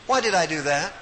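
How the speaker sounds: ordinary talk, nothing marked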